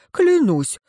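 high, falling oath of innocence; earnest protest pitched well up; fervent swearing. A narrator's reading. Градосмотритель клянётся, оправдываясь.

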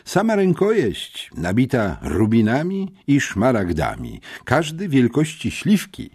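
high, animated boasting of treasures. A narrator's reading. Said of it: "z pozycji jubilera zachwalającego klientowi kolekcję".

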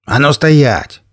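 sharp shouted command. Acted. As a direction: bark the order out. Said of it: "Неожиданный окрик".

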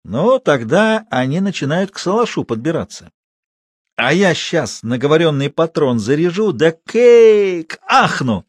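yarn-spinner leaping up at the climax; excited old watchman. A narrator's reading. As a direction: build up to a drawn-out high blast. Матвей азартно рассказывает.

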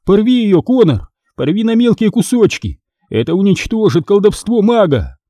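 high, insistent narrated shout; urgent cry. A narrator's reading. Boy begging friend to tear the card, crying out. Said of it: "закричал я".

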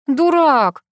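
high, loud mock-insult; indignant yell. A narrator's reading. Girl yells at her teasing friend, indignant. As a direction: cry out, pitch and volume up. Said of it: "завопила я".

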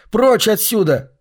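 high, slow, desperate shout. A narrator's reading. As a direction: scream it away slowly. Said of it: "выкрикнул он".

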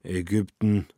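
usual speaking voice

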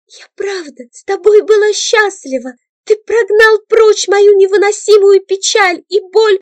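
put-on girl apologizing and confessing through tears; tearful confession. A narrator's reading. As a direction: say sorry, then pour out your pain.

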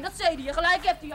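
high, indignant last jab; heated finish. Acted. Girl finishes telling off the priest, high and indignant. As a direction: drive the point home. Girl ends her outburst.

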